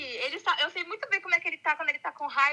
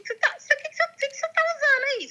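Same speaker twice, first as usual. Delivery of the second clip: much higher, baffled questioning; real incredulity; 9 semitones higher than usual. Real, not acted. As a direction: question them in high-pitched disbelief. Friend disbelieves the prank story.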